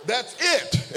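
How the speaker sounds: a high, ringing "That's it"; shouted. Real, not acted. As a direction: shout the point home. Preacher shouts the point.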